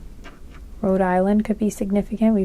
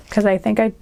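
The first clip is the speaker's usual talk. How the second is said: usual speaking voice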